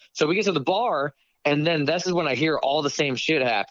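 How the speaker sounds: ordinary talk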